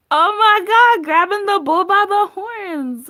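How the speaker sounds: high, loud burst of excitement; excited exclaim. Real, not acted. Reader reacting to the bull-by-the-horns card, excited and loud. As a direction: blurt it out, thrilled by the card.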